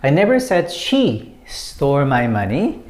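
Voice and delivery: "she" lifted and held, then a small break before the rest; single out the word "she"; stress on SHE. Shown as a demonstration. Teacher showing how emphasis changes meaning, stress on "she". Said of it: "when you put emphasis on she".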